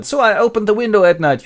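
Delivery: high, loud put-on complaint; Lucy's mother. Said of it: "said Lucy's mother".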